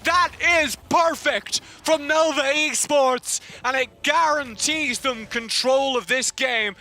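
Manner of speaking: high, loud, slow hype call; excited shout. Real, not acted. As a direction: belt it out like a winning moment. Game-deciding fight called live.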